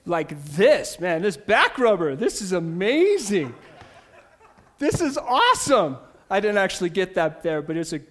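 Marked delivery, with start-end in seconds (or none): 1.0-6.0 playful gushing over a back rubber, laughing at the end; amused mock rave; mock delight. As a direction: ham up the thrill of a gadget. Showing off a gift back rubber.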